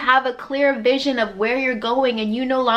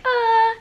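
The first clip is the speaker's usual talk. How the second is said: high soft heavenly Ah; light angelic sigh; gentle angelic Ah; 10 semitones higher than usual. Pretend. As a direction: croon it tenderly like an angel. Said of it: "soft, nurturing, gentle".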